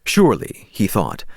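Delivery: usual speaking voice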